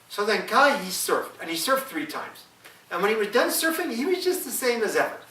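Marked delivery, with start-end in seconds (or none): none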